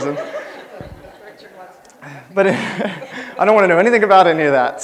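loud, laughing, dragged out; laughing aside. Real, not acted. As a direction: laugh it off, slowed. Joking about wedding prep.

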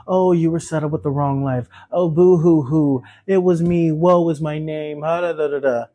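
mocking whiny boo hoo; sarcastic pity-party sob voice; fake crying. Mocking self-pity of blamers.

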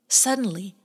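ordinary delivery

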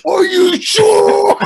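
very high, slow, drawn-out mock scream; hammed-up coach's shriek; in-your-face yell. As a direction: blast it right into their face. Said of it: "he comes in my face".

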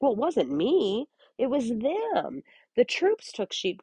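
high, slow whiny blame-shifting; excuse-making Saul. Retold or imitated. Saul dodging blame before Samuel, high whiny excuse. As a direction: pass the blame like a sulky child. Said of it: "he's like".

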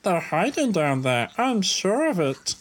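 very high put-on character voice; Lloyd voice. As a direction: pitch it way up, almost a squeak.

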